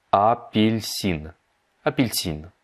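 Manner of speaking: "апельсин" drawn out syllable by syllable; slowed-down model. Shown as a demonstration. Teacher breaks down the Russian for orange, very slow. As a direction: stretch "апельсин" very slowly, each syllable apart.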